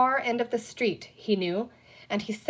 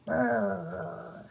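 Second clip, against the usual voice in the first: soft engine noise; 4 dB quieter than usual. A narrator's reading. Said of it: "said Peter to himself".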